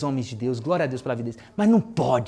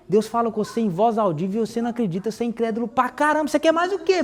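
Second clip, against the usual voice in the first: higher-pitched retold telling-off, climbing at the end; exasperated mock scolding; 6 semitones higher than usual. Mother scolding her doubting son, exasperated and rising. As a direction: imitate a mother losing patience, pitch rising.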